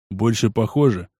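usual speaking voice

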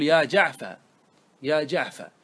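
"جعفة" ending in open a, no r; final fatha. Shown as a demonstration. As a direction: end "جعفة" on a short ah, the r left off. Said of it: "فتحة، لأنك كأن".

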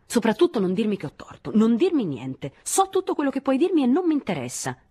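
high-pitched, heated outburst; shrieked-up refusal to listen; angry yelling. A narrator's reading. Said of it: "si mise a gridare anche lei".

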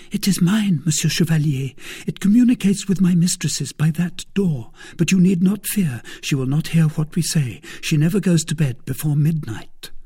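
put-on high, eager young servant girl; timid maid reassuring a visitor. Kitty voiced high.

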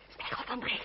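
whispered hurry; urgent whisper. Acted. Urging her cousin to flee as riders near, whispered. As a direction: whisper him onto the horse.

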